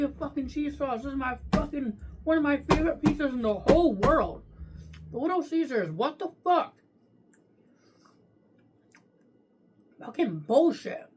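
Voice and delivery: loud, frustrated outburst, pitch climbing; venting hard at the wrong order; fed-up loud complaining; real angry rant. Real, not acted. Angry rant about wrong pizza.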